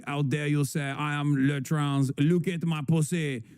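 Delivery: put-on camp French-accented old lady, playfully; hammed-up French accent; mocking French old lady. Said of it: "an old lady pedophile being like".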